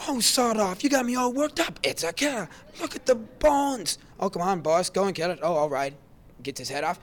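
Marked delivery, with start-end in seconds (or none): 0.1-4.0 high, loud, worked-up quoting of a frightened knight; excited knight quote. Retold or imitated. Friend reenacting a Holy Grail scene, loud and panicky. Acting out Monty Python scene. 4.2-5.9 louder voice urging the boss on; loud film quote. Retold or imitated. Friend reenacting Holy Grail knights, loud and pushy. Acting out Monty Python scene.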